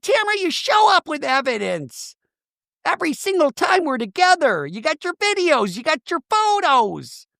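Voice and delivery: high-pitched mock whine, slowing at the end; mimicking Shannon. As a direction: whine it up high, drag out the list. Shannon complaining at Tamara.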